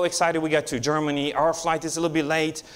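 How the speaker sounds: ordinary talk, nothing marked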